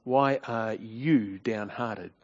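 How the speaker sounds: husband quietly reproaching his downhearted wife; tone of accusation. Shown as a demonstration. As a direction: press each question with quiet blame. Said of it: "Notice the tone of accusation".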